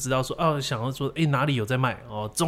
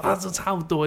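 retold surprise, pitched up; surprised mom; 7 semitones higher than usual. Mom reacting to the price gap, retold surprise. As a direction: imitate a mom's startled reaction. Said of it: "这样的惊讶".

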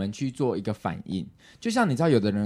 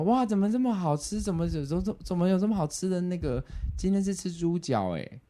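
imitating family member; 7 semitones higher than usual. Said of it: "你知道说什么".

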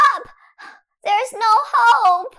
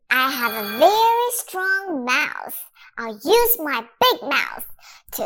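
a much lower, boastful dinosaur; big dinosaur voice; 11 semitones lower than usual. Acted. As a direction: puff up and go low like a big beast.

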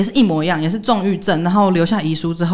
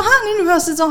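incredulous; 13 semitones higher, 3 dB louder, faster than usual. Retold or imitated. Asking back in disbelief.

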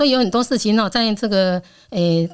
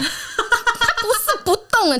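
laughing disbelief; 11 semitones higher, much slower than usual. Real, not acted. Amused at the fake patient.

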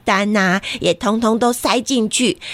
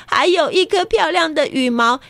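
plain reading voice

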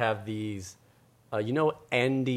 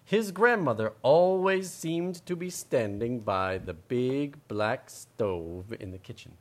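slow storybook narration; storybook reading; 5 semitones higher, 5 dB louder, slower than usual. A narrator's reading. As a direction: read it slowly to children. Reading story aloud to kids.

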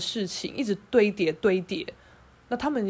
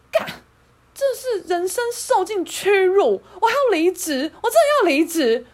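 furious complaint; 8 semitones higher, 7 dB louder than usual. Retold or imitated. Calling a friend after the fight, loudly furious. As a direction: vent the humiliation to a friend.

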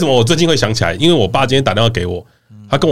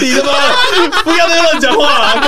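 laughing scold. Acted. Laughing mock scolding of co-host.

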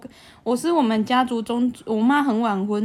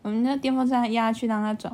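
usual speaking voice

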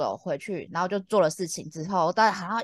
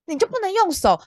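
exasperated; 11 semitones higher, 4 dB louder than usual. Real, not acted. Complaining about chin pointing.

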